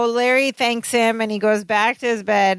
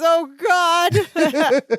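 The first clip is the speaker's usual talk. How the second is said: high, drawn-out mock despair; Balki; 8 semitones higher than usual. Prisoner finding no doorway in an earthquake, panicked. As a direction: wail it in hammed-up panic.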